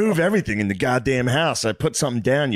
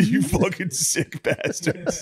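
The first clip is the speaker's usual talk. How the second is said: slow drugged snarl; 6 semitones higher, much slower than usual. Retold or imitated. Imitating drugged patient slowly.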